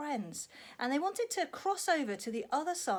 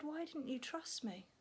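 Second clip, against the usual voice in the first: soft, tender asking; gentle question; 4 semitones higher, 9 dB quieter than usual. Acted. Jesus gently asking his friends, soft and tender. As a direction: ask it kindly.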